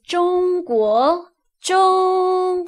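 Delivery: 中 held high and level, 国 rising; first tone. Shown as a demonstration. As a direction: stretch 中 flat and high. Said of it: "first tone".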